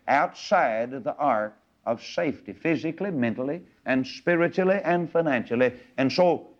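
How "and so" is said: normal talking voice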